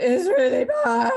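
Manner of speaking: high, loud, drawn-out mock wail; tearful wail. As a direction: imitate wailing down the phone in tears. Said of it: "because I never cry".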